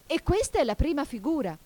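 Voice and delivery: ordinary delivery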